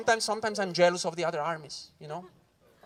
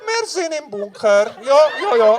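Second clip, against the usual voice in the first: loud, high Swiss German soldier shouting back; Swiss German accent; 12 semitones higher, 10 dB louder, slower than usual. Acted. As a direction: bellow it like a cheery Swiss German grunt. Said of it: "He goes".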